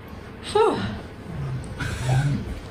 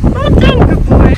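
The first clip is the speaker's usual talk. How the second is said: high, loud praise for the pig; 12 semitones higher, 8 dB louder than usual. Real, not acted. Owner praising her pig over the wind, loud and high. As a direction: cheer him on over the wind.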